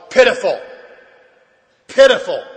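"pitiful" punched out loud; emphatic stress. Real, not acted. Speaker confessing his bottom, hammering the word "pitiful". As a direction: hit "pitiful" hard each time, set apart by a pause. Hammering home how low he sank.

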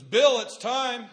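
loud, slow call of his name. Retold or imitated. Mother calling her son to change her, slow loud call. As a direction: call him in from the other room. Mother calls him for diaper.

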